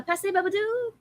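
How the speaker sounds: Babadook said the French way, high and light, bah-bah-DOOK; a playful fake French accent on Babadook. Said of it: "say Babadook in a French accent".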